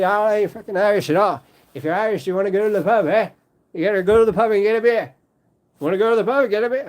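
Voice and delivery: put-on high, loud, fast Irish pub accent; hammed-up fast Irish accent; mock Irish accent. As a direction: bellow it like a rowdy Irish drinker. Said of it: "how do you do the Dublin accent".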